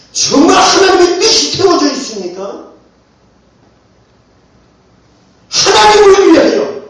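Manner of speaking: high, loud pulpit challenge; forceful preaching. Real, not acted. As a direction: raise it to a ringing slogan.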